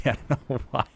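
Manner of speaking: words broken up by laughter; giggling bafflement. Real, not acted. Commentator puzzled by his own slip, giggling. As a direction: laugh helplessly as you get it out.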